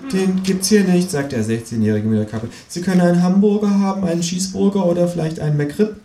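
put-on leicht angedeuteter Teenie-Kassierer, höher; gelangweilter Kassierer. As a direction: dienstbeflissen abwimmeln. Kassierer, Figurenstimme. (leicht gespielter junger Kassierer)

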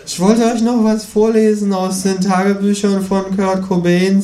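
the kangaroo muses smugly, spelling out each item; lecturing kangaroo. A narrator's reading. Kangaroo lectures the ghost and narrator on evolution.

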